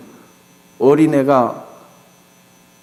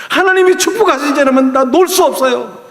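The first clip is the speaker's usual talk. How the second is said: high, loud crying-out prayer; tearful plea; 8 semitones higher, 3 dB louder than usual. Acted. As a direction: beg through tears as if your life depends on it. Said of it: "막 눈물 흘리면서".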